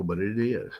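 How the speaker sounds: ordinary delivery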